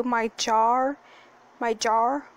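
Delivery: "jar" with the r softened toward w; "jar" said with a w-like r, her own speech difficulty; says w for r. Real, not acted. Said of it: "see, I can't say it".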